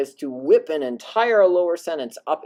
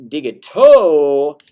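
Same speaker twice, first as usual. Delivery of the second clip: rising dative; 12 dB louder, slower than usual. Shown as a demonstration. Teacher demonstrating a rising dative pitch. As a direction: voice lifted from below. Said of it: "Hear how you pull it up".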